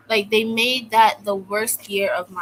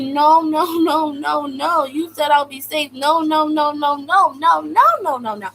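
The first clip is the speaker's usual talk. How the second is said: loud, higher, frantic string of no; panicked refusal; 7 semitones higher, 6 dB louder than usual. Retold or imitated. Girl pleading with guards not to move her, panicked. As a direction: beg them not to lock you up.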